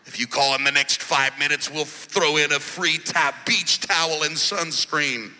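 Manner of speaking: low, smooth radio ad announcer; infomercial. Retold or imitated. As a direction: sell it like a radio ad. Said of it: "the ad you hear on the radio".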